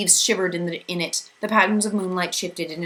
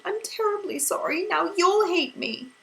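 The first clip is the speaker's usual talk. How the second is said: much higher, slow tearful voice; sobbing; 13 semitones higher than usual. A narrator's reading. Meg fearing Calvin will hate her, tearful. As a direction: plead through the sobs. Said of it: "she sobbed finally".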